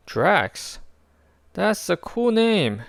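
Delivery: put-on a bright impressed young girl; girl thinks the name is cool; Katz voice. A narrator's reading.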